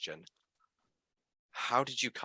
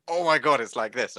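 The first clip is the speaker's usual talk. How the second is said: loud, high burst on "Oh my God"; excited retold outburst; 4 semitones higher, 9 dB louder than usual. Host retelling his email to the editor, excitedly. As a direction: gush it like breaking news, then ease off.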